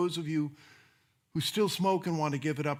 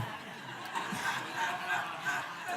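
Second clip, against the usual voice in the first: soft breathy chuckle; 9 dB quieter than usual. Real, not acted. Joke about rolling cigarettes.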